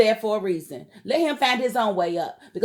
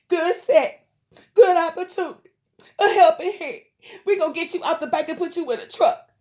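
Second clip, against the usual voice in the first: higher, louder heated rant; indignant rant; 6 semitones higher, 4 dB louder than usual. Real, not acted. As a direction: vent on behalf of every woman. Ranting for women against men.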